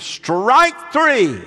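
screamed. Retold or imitated. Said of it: "and screamed".

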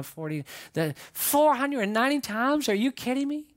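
playing a flabbergasted disciple; acted incredulity. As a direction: balk at the huge total. Peter incredulous at the total.

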